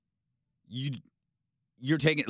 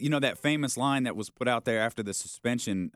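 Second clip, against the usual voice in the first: ordinary delivery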